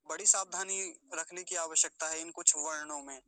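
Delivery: normal talking voice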